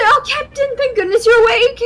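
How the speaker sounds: high, bright-eyed greeting to the captain; eager, hopeful gush. A narrator's reading. Althera greeting the waking captain, overjoyed and hopeful.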